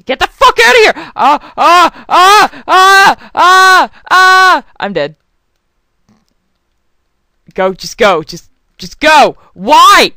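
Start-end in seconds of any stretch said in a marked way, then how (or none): 0.0-1.1 much higher, much louder panicked yell; frustrated scream. Real, not acted. Screaming at enemies. 1.1-4.6 high, loud repeated yelps; panicked screams. Real, not acted. Screaming while attacked. 7.5-10.1 louder, rising exasperated shout; frustrated yelling. Real, not acted. Yelling at game.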